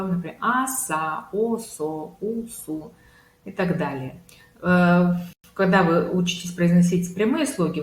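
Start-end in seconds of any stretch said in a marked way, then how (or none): 0.4-2.9 separated vowel-then-syllable pairs; syllable drill. Shown as a demonstration. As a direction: sound out А СА, О СО, У СУ piece by piece. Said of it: "как произносится в серединке слова".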